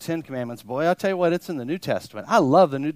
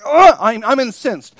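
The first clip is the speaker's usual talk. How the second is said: higher, louder huffy fuming; mock outrage; 7 semitones higher, 11 dB louder than usual. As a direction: play someone fuming, hammed-up. Mimics angry congregant.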